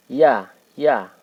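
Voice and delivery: "ya" with a dropped jaw, loud; over enunciating. Shown as a demonstration. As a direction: drill "ya" with a wide mouth. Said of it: "Coba saudaraku bisa dilatih".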